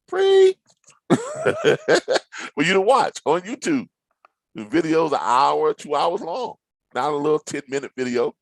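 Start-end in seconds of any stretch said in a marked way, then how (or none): 0.1-0.6 much higher, louder delighted cry; mock excited squeal. To viewers about free videos, hammed-up squeal. Mocking astonishment again. 1.1-3.9 laughing. Real, not acted. Laughing at own joke.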